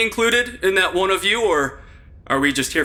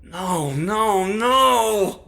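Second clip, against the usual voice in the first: rising alarm; 3 semitones higher, much slower than usual. Acted. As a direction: panic growing with each no. Discovers body missing.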